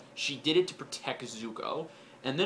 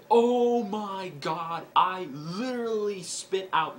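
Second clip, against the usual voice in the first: oh my god, higher, louder and slow; excited; 6 semitones higher, 4 dB louder, slower than usual. Retold or imitated. Recalling spitting out soda, slow excited gasp. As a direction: relive the shock of the twist. Reacting to the spoiler twist.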